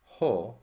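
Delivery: a clear, loud "Ho" with rough breathing; ho said aloud. Shown as a demonstration. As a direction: sound out "Ho" with a breathy h.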